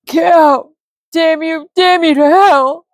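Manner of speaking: high, loud, slow tearful cursing; grieving widower damning the wizard; sobbing curse. Acted. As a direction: curse him from the floor, sobbing. Sobbing man curses him.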